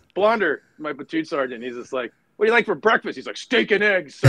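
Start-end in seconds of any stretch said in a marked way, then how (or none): 2.4-3.2 higher, brisk question; friendly colonel. Retold or imitated. Colonel asks platoon sergeant. 3.4-4.3 higher, keen reply; eager sergeant. Retold or imitated. Sergeant answering colonel.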